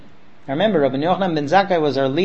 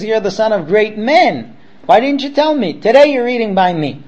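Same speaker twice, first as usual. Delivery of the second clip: retold amazement, pitched high and louder; amazed reproach; 5 semitones higher, 5 dB louder than usual. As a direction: exclaim it as a surprised teacher. Rabbi learns the student's lineage.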